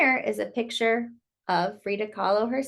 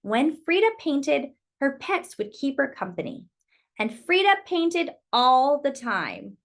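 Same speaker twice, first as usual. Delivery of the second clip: story narration. Acted. Reading Frida's story to children, lilting storyteller voice. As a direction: tell it like a bedtime picture book. Reads picture book aloud.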